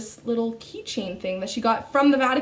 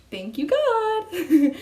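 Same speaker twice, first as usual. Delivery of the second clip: high, slow, drawn-out thanks to God; grateful thank you; 8 semitones higher, 3 dB louder, much slower than usual. Retold or imitated. Retelling her thanks to God when nobody took it, high and slow. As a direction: lift it up slowly in relief. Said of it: "So then I was like".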